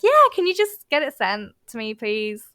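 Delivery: nice phone voice. Shown as a demonstration. Showing how the same request sounds by phone, nice phone voice. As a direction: soften the ask with a cheery phone lilt. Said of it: "I'm a lot nicer on the phone".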